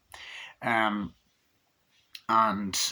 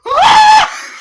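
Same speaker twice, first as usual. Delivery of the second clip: piercing, very high mock scream; loud falsetto horror-film scream; over-the-top scream; 17 dB louder than usual. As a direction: shriek like a hammy movie mother. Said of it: "gives the most over the top".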